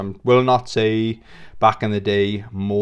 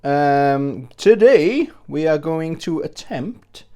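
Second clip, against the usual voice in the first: higher goofy presenter voice, slow at times; silly put-on voice; 5 semitones higher, 4 dB louder than usual. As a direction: ham up a cheesy host voice. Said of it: "I'm not gonna do the whole video like this".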